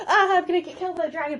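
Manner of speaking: high, rushed pretend panic; hammed-up cry for help, words tumbling out; mock distress. Hiccup about to be killed by the dragon, mock distress. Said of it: "Toothless hears my distress".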